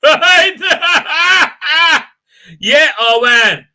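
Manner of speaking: high, loud burst of laughter into a slow oh man. Real, not acted. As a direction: crack up at your own joke. Laughs at his casting idea.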